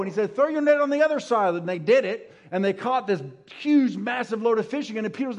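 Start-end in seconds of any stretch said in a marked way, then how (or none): 0.4-1.5 high, loud, quick call. Acted. Jesus calling from the shore to the boat, high and loud. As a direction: shout it out to the boat.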